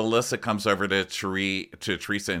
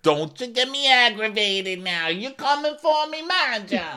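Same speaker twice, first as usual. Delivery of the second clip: much higher, louder Jersey warning; mock Gia; 3 dB louder than usual. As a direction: threaten in a shrill-pitched Jersey voice. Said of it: "she's like".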